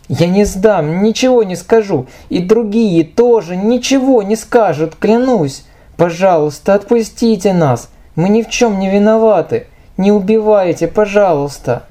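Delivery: high, pleading begging for mercy; terrified pleading. Acted. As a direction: beg for your life. Captive begs bandits for life.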